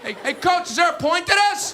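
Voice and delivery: put-on high-pitched exasperated kid's holler; calling to coach. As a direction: break off the chant to question the coach loudly. Fielder calls out to coach.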